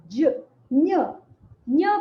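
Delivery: ज begun, then न्य as "nya"; sound broken down. Shown as a demonstration. Teacher shows students the steps, ज then न्य "nya". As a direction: attempt ज, then release a firm न्य, "nya". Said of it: "आप जा बोलने का प्रयास करें".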